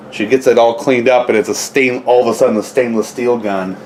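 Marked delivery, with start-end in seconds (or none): none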